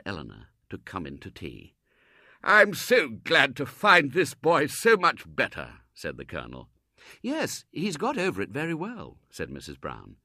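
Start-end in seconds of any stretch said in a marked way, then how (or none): 2.4-5.8 slow, loud, high gushing praise; overflowing with gratitude; effusive Colonel. Acted. Colonel praising Robert's generosity, loud and gushing. 7.2-9.2 higher, placid mother's reply; calm and homely; lightly voiced mother. Mrs Brown answering the Colonel, lightly voiced and placid.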